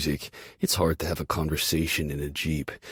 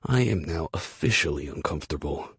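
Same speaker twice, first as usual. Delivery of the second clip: ordinary delivery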